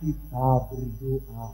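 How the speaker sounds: retold slow, spaced-out repeat. Priest repeats to hard-of-hearing grandma that they will pray, slow repeat. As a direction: say it slowly, word by word. Repeats for woman who didn't hear.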